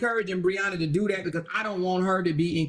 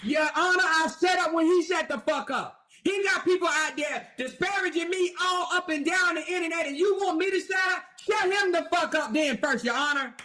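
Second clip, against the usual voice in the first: higher, yelling back at the judge; ranting at judge; 5 semitones higher than usual. Pretend. Amara yelling back at the judge, higher and heated. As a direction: shout her case at the bench. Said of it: "I'd be like".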